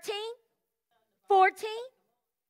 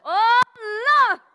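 loud, joyful cry to the Lord; excited shout; 2 semitones higher, 7 dB louder than usual. Retold or imitated. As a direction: shout it out, overcome with joy.

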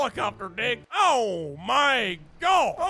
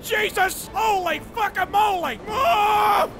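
panicked yelling; 5 semitones higher, slower than usual. Real, not acted. Gamer crashing the Rick and Morty ship, yelling help. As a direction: yell in mock panic as you fall.

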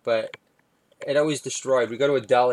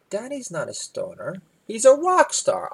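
put-on piping child voice swelling with pride; proud little daughter; 8 semitones higher than usual. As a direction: defend daddy like an adoring kid. Daughter defending dad, voiced.

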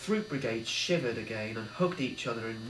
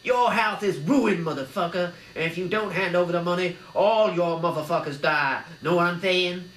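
gangster shout; 4 semitones higher, 5 dB louder than usual. A narrator's reading. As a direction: threaten the whole house. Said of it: "shouted Arnie".

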